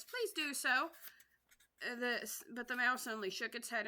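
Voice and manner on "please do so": chorus plea. Acted. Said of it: "the others all joined in chorus".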